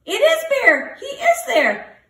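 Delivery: high happy squawking birds; joyful squawk. A narrator's reading. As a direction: squawk it out with glee. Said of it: "all squawk from the sky".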